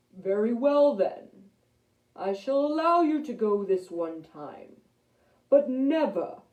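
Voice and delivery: stern lion king, loud then easing off; big booming voice. A narrator's reading. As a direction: grant pardon with a royal warning. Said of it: "a very big booming voice".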